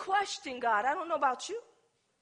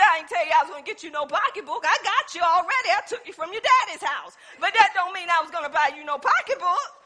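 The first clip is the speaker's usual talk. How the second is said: fast, chuckling excuse from the husband; husband laughing off the gift, quick; laughing brush-off; faster than usual. Retold or imitated. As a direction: toss it off with a laugh. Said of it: "He said".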